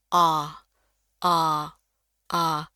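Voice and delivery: "A" ouvert, entre a et o, allongé; A épais. Shown as a demonstration. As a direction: ouvrir grand sur "A", mâchoire tombante. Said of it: "bunyinya tuh tebal".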